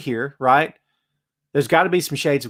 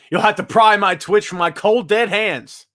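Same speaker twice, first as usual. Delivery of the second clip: mock defiant Paige; 6 semitones higher than usual. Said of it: "That's Paige".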